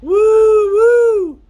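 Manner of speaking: high, loud, sustained hoot back; answering cave call. Acted. Response coming out of the cave, high loud hoot. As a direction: answer with two joined high "woo" hoots. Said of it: "he hears a".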